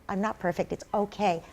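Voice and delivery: soft, gentle self-reassurance; forgiving yourself in a softened voice; self-compassion. Acted. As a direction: soothe yourself quietly. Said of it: "having compassion for yourself and just say".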